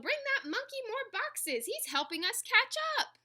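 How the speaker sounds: high, rising storybook shout; excited yell. A narrator's reading. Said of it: "Someone noticed and yelled".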